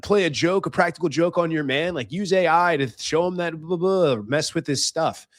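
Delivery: mock influencer. Influencer urging women to prank their man, mock influencer. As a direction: imitate an influencer pitching a prank to the ladies. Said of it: "saw something on TikTok or on Instagram".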